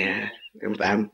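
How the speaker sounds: usual speaking voice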